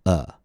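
"uh" with tongue relaxed and central; schwa UH. Shown as a demonstration. Teacher contrasts it with ah for learners, relaxed schwa UH. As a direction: neutral lips, a central "uh", never an open "ah". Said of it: "Keep your tongue relaxed and central".

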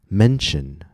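teacher's slow model words, each first syllable lifted and stretched; first-syllable stress. Shown as a demonstration. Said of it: "Make sure that the first syllable has a higher pitch and a longer vowel".

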